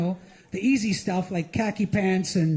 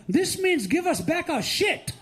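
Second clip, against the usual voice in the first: put-on Indian accent; 7 semitones higher than usual. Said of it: "the Indians were like".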